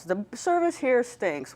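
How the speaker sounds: ordinary talk, nothing marked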